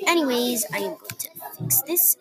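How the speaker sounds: ordinary delivery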